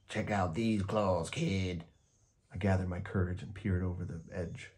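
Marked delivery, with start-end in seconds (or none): 0.1-1.8 rasping monster voice. A narrator's reading. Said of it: "a third voice from under the bed rasped".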